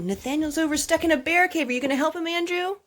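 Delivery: usual speaking voice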